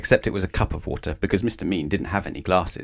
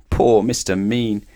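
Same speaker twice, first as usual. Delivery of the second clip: ordinary delivery, nothing marked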